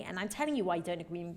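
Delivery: ordinary talk, nothing marked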